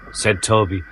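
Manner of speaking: ordinary delivery, nothing marked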